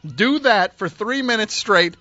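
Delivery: high, drawn-out exasperated scolding; indignant. Real, not acted. Host scolds caller, then himself.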